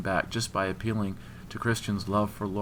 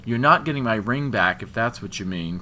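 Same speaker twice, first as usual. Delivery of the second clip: defiant refusal; 4 semitones higher, 8 dB louder than usual. A narrator's reading.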